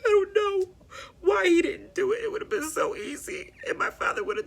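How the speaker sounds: high, cracked crying voice; words squeezed out through tears; real crying. Real, not acted. Crying over God not healing.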